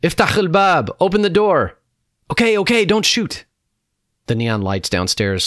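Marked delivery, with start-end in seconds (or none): 0.0-1.8 loud, high-pitched military shout; urgent high yell to open up; shouted order. A narrator's reading. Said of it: "Shouting echoed throughout the valley". 2.3-3.4 high, urgent call back; loud high-pitched surrendering shout; shouted plea. A narrator's reading. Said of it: "Shouting echoed throughout the valley".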